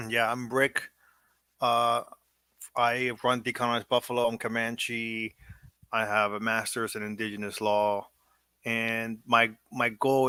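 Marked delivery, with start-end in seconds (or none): none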